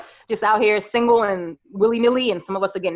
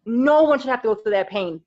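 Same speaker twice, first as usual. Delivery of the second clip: ordinary delivery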